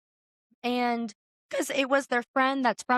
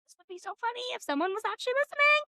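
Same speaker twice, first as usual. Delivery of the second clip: giddy inner voice; 12 semitones higher than usual. Retold or imitated. Retold thought, excited.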